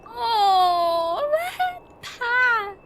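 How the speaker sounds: high, loud, anguished outcry; distressed wail. Real, not acted. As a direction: wail it out in dismay. Upset that memories will be wiped.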